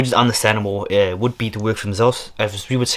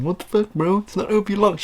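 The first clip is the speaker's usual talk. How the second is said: higher, whiny mock of a viewer; a hint of a nagging viewer, played for laughs; mocking complaining viewer; 9 semitones higher than usual. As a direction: mimic an annoyed commenter, pitched up. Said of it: "some of y'all be bitching and saying".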